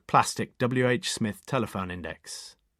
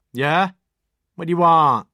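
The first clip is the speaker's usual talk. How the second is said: higher, louder, curt Oxfordshire woman on the phone; rude local woman; 8 semitones higher, 12 dB louder than usual. A narrator's reading. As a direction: snap down the phone. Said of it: "A woman's voice".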